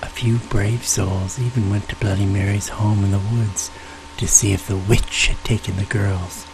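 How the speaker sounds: plain reading voice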